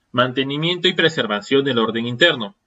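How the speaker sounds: ordinary talk, nothing marked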